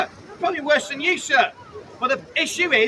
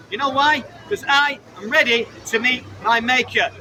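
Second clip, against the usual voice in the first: ordinary talk, nothing marked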